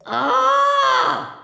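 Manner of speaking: sudden high startled shout; loud shriek of alarm; startled cry. Acted. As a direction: jump at the fright. Cook startled by rats.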